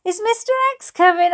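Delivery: put-on high, quick, loud child's question; abrupt question. Child interrupting the grown-ups, abrupt. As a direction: blurt it out fast and high. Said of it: "I asked abruptly".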